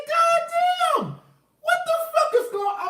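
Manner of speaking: laughing shout of disbelief; shocked shout. Retold or imitated. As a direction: yell it out in stunned glee. Shocked she asks for the address.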